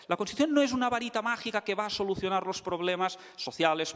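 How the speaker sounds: ordinary delivery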